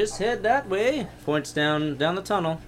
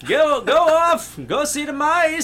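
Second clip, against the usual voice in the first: put-on old guard voice; 9 semitones higher than usual. Guard dismisses.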